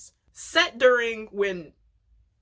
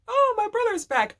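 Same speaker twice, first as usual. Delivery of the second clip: surprised Stan; 14 semitones higher, 3 dB louder than usual. Retold or imitated. Stan greeting returning brother.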